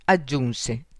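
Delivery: usual speaking voice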